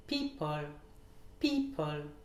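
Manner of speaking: PEO stressed in "People", falling second syllable; first-syllable stress. Shown as a demonstration. Teacher models a common word, stress on PEO in "People". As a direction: hit the start: PEO-ple. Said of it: "l'accentuation sur la première syllabe".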